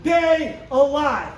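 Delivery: high, loud, punched-out stage tirade; shouted stand-up rant. Acted. Comedian signing off to a crowd, defiant shouted rant. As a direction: belt it at the crowd, hammering every swear word.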